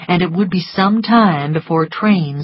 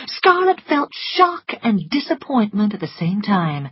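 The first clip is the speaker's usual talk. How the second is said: plain reading voice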